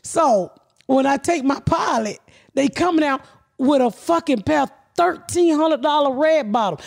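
ordinary delivery

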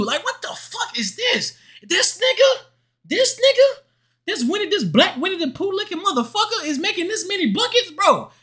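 incredulous outburst. Real, not acted. Disbelief at opponent's hot stats.

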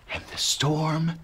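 slow, whispered storytelling; soft, awed whisper with long pauses; hushed whisper. A narrator's reading. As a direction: drop to a slow hush for the sudden calm. Hushed moment as storm ends.

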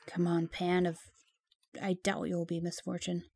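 ordinary talk, nothing marked

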